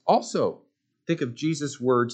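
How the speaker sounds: ordinary delivery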